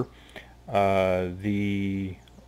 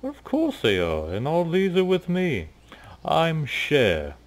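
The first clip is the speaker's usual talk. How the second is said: high, showy self-introduction; tiger Cher; 9 semitones higher, 3 dB louder than usual. Acted. Cher introduces himself to Maya, showy. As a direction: preen as you give your name.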